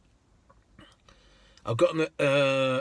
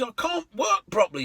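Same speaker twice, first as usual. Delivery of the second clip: much higher, indignant woman's voice; exasperated lady; 8 semitones higher than usual. Retold or imitated. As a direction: complain with disbelief.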